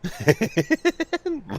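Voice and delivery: high, brief giggle; a quick amused giggle; real giggle; high laugh. Real, not acted.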